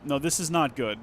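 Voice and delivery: ordinary delivery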